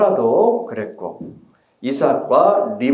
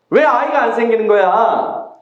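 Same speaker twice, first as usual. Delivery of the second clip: frustrated Rachel; 8 semitones higher than usual. Acted. Rachel complains she cannot conceive.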